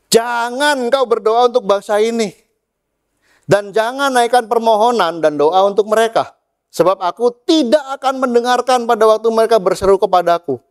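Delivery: high, emphatic acted rebuke; exasperated God. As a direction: forbid it as a fed-up God. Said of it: "saking Tuhan keselnya".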